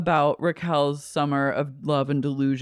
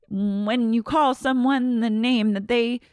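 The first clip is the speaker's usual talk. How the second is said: higher, lecturing retold quote; pointed lecture; 6 semitones higher than usual. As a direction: imitate an earnest correction, pitched up. Acting out Raquel's lecture.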